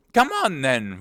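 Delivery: high, loud roared command; roaring queen. Acted. Queen calling Alice to the procession, roaring. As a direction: roar it out as a summons. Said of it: "roared the queen".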